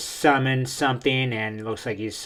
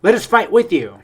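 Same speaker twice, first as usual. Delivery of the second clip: raised, louder pleading call; shouted plea; 7 semitones higher, 6 dB louder than usual. Acted. Young ninja shouting out to father, pleading shout. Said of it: "he shouts out".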